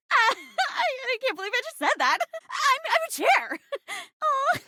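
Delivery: high, embarrassed squeal with laughs; flustered giggling. Acted. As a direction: blurt it high, giggling at yourself. Flustered after an embarrassing admission.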